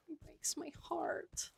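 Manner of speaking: a hushed, aching whisper; whispered heartbreak. Real, not acted. Streamer whispers to chat after sad dialogue, hushed. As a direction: whisper it, moved by the scene.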